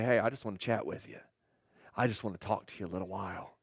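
soft, low voice of God leaning close; gentle near-whisper, imitated. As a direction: speak low and softly, as an intimate invitation. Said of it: "lean down and just whisper".

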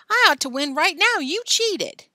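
high, loud demand; indignant yelling. Acted. Billy claims the bet for their cheating, indignant yelling. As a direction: yell your claim indignantly.

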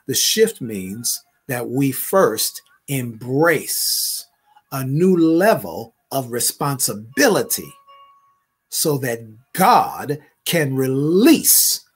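climbing prophetic declaration; preaching at full force. Acted. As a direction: lift the congregation line by line. Prophetic word builds to preaching.

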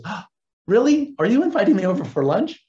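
gasp, then ask it high and quick; acting surprised. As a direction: imitate a startled, delighted question. Retold surprised reaction to invitation.

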